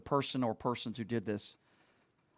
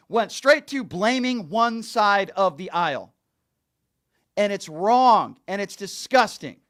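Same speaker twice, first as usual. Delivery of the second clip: louder and higher, real indignation, slowing to hammer the last words; heated rant; 6 semitones higher, 8 dB louder than usual. Real, not acted. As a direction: let the outrage build and bite down on wrong and disgusting. Host rails against partisan blame.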